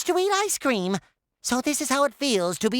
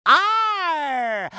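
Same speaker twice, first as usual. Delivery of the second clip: long, drawn-out pirate growl of arr; stretched pirate cry. Acted. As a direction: relish a big hearty arr. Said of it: "so I can say arr".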